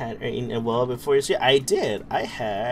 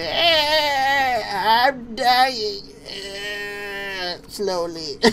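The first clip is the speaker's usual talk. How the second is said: put-on old person voice; 8 semitones higher, much slower than usual. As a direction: play a feeble old customer. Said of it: "And it's like".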